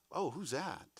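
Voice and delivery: soft, curious "Oh, who's that?" with a quiet lift; a man noticing a woman, asking softly; curious inflection. Shown as a demonstration. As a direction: let the "Oh" linger with interest. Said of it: "You hear my inflection".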